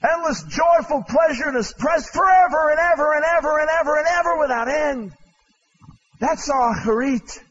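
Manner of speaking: high, ringing preacher's cry rising to a shout; preaching at full force; fiery preaching. Acted. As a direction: proclaim heaven at full force. Preaching on eternal joy.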